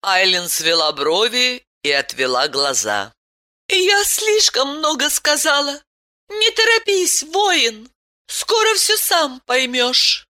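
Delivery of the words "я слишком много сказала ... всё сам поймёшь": Aylen's voice. A narrator's reading. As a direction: voice her gently, well above your own.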